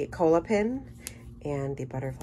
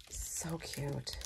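breathy whispered aside, softer; 11 dB quieter than usual. Real, not acted. Crafter admiring a cake stand, whispered. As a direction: hush it like a secret delight.

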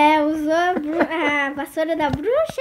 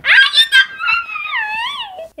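laughing shriek; 6 dB louder, much slower than usual. Real, not acted. Spotted by seeker, shrieks.

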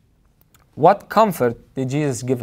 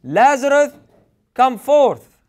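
high, loud call of Lazarus; loud cry; 8 semitones higher, 3 dB louder, slower than usual. A narrator's reading. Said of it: "He cried out with a loud voice".

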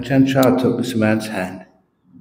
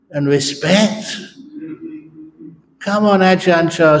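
mildly hammed-up insistent visitor; demanding man; 7 semitones higher than usual. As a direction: press the monk for the favour. Man demands a palm reading.

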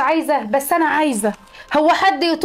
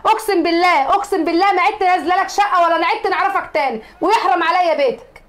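a mother-in-law swearing she will never come back; furious tirade; 3 semitones higher than usual. Acted. Grandma rages and swears an oath. (توبيخ غاضب بصوت عالٍ)

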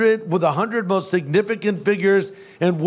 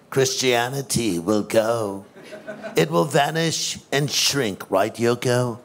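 [name] impression; 6 semitones lower than usual. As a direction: play [name] in a lazy, low Liverpool accent. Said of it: "say it as John would have said it".

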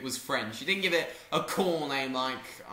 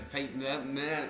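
normal talking voice

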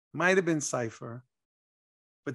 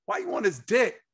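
mock caller rant; 8 semitones higher, 6 dB louder than usual. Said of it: "this dude live on the air said".

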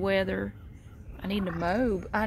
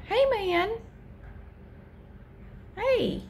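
much higher, cooing hello; high pet-talk greeting; 11 semitones higher, slower than usual. Real, not acted. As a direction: greet the cat sweetly. Greeting the cat.

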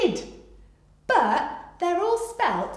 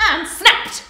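sudden loud, higher snap; snapping; 8 semitones higher, 14 dB louder than usual. Pretend. Storyteller acting out the crocodile, snapping. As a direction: snap the word out like jaws.

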